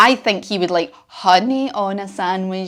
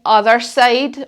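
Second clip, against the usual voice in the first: ordinary talk, nothing marked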